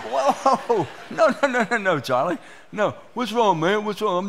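high, loud panicked "whoa, no, no"; alarmed pleading. Retold or imitated. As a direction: back away in a loud retold panic. Dentist friend coming at his eye.

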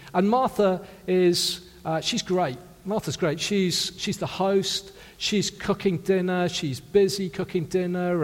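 normal talking voice

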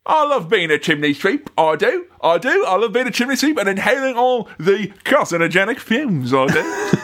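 hammed-up chimney sweep impression; Bert. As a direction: do a bad music-hall chimney sweep. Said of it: "Not only bloody Bert".

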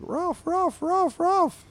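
very high-pitched cartoon dog barks; barking dog. Dog pestering Drabble in his house, high barking. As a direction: yap the words like a small dog. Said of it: "the dog's kinda looking behind him, going like".

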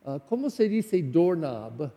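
ordinary talk, nothing marked

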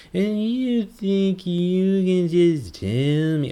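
mock drunk voice. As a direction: ham up a sloppy drunk, dragging every word. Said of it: "that's basically what a drunk person sounds like".

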